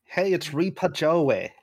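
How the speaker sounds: broad mock Philadelphia accent; heavy Philly accent. Player trying out a character's catchphrase, heavy Philly accent. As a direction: ham up a local Philly accent. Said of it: "like, a heavy Philly accent".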